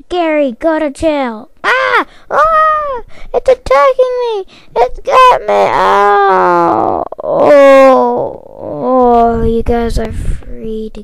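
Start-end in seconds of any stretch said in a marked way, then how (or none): 1.6-5.7 much higher, louder panicked yelling; panicked screams. Acted. Patrick attacked by Gary, screaming high in panic. 5.7-9.4 loud pained shouting; pained cries. Acted. Patrick mauled by the cat, loud cries. Attacked, crying out.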